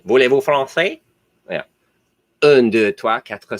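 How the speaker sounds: a light put-on French accent; mock French. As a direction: toss off some playful French. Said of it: "French now".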